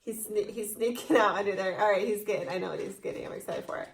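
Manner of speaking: ordinary talk, nothing marked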